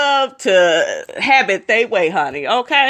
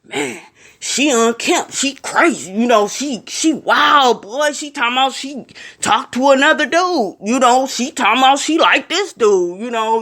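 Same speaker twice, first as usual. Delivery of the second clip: imitating the men; 4 semitones higher than usual. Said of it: "the first thing they wanna call".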